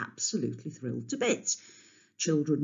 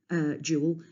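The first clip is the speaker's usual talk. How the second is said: usual speaking voice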